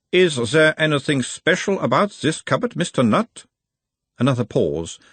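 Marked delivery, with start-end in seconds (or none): 0.1-3.5 put-on high, probing analyst in Uberwald accent; Uberwald-accented therapist, pitched well up; mild Uberwald accent. A narrator's reading. Nutt as analyst asks about his mother, Uberwald accent. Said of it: "asked in a mild Uberwaldian accent".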